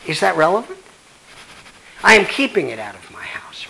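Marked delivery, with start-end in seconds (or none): 2.7-3.7 unvoiced whisper, half to himself; whispered aside. Real, not acted. Whispering to the audience about keeping his data home. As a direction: breathe it out as a confiding whisper.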